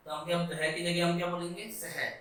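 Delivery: usual speaking voice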